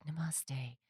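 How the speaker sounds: hushed, put-on namaste voice; yoga-person voice. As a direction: parody a spiritual yoga devotee. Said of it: "they have this voice like this".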